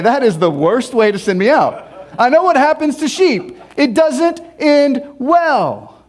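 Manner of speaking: loud, high comic objection; mock protest. Disciple objecting to being sent like sheep, mock protest. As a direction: object loudly, dragging out the last words. Acted protest to Jesus' send-off.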